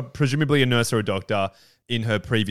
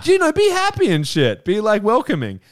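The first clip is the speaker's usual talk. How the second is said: mock cheery welcome; 10 semitones higher, 3 dB louder than usual. Mocking cheery Southern hospitality.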